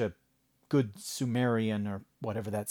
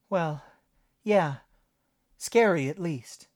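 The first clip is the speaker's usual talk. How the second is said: put-on young woman voice; 8 semitones higher, 6 dB louder, slower than usual. Jenny's dialogue voice.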